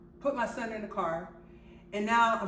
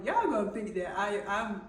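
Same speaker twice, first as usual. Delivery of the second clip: usual speaking voice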